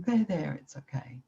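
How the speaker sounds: soft, fading there there; soothing a suffering friend, voice dropping to a near hush; gentle soothing. Shown as a demonstration. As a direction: hush them gently like a hurt child. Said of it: "your voice was gentle".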